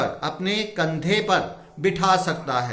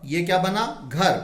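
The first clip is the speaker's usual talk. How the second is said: ordinary talk, nothing marked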